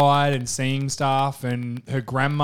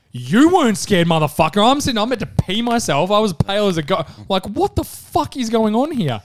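louder, higher retold protest; indignant outburst; 7 semitones higher, 3 dB louder than usual. Telling girlfriend how scared he was, indignant. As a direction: protest in disbelief. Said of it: "And I'm like".

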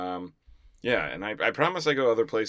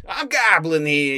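put-on Newark accent; 6 semitones higher, 6 dB louder, slower than usual. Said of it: "You didn't do the Newark accent".

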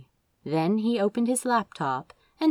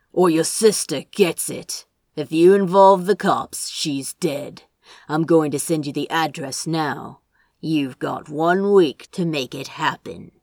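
louder, hard kidnapper voice; menacing threat; 2 semitones lower, 5 dB louder than usual. A narrator's reading. Kidnapper threatens brother over phone, menacing. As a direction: threaten coldly, step by step.

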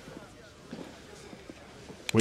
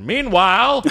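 high announcer meanwhile; narrator voice; 12 semitones higher, slower than usual. Retold or imitated. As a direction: boom it like a TV narrator. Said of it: "there wasn't a voice that said".

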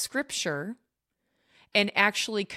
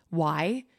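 normal talking voice

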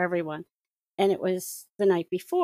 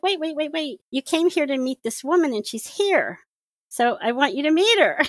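higher, louder eager plea to wait; eager calling out; 7 semitones higher, 4 dB louder than usual. Retold or imitated. Woman stopping the narrator at the exit, eager and higher. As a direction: hurry to stop her from leaving.

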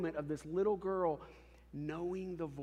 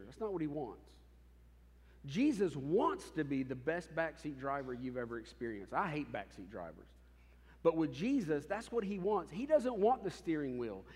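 usual speaking voice

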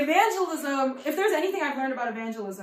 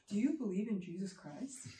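low, much softer mock-mother voice; sudden hushed question; 4 semitones lower, 11 dB quieter than usual. Mother springing religion on the taxi driver, suddenly low and hushed. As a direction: drop the chatter and ask it low and quiet. Mother abruptly turns to faith.

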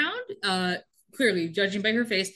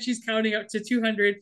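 ordinary talk

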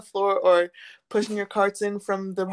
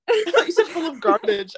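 laughing; 8 semitones higher, 6 dB louder than usual. Real, not acted. Laughing at misheard words.

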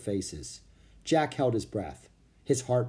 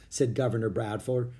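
ordinary delivery, nothing marked

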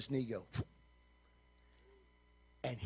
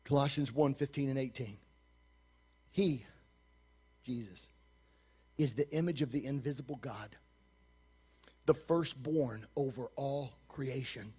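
scripture reading; 8 semitones lower, 8 dB quieter than usual. Acted. Reads scripture aloud.

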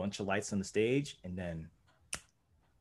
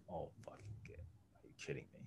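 dismayed whisper; 5 semitones lower, 19 dB quieter, faster than usual. Retold or imitated. Muttering in dismay to himself.

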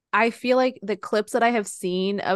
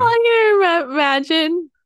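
laughing; 10 semitones higher, 8 dB louder than usual. Real, not acted. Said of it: "[name]'s laughing silently".

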